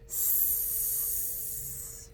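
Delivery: hissing like a snake; quiet snake hiss. Shown as a demonstration. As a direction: hiss the s out soft. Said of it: "make the sound like of a snake".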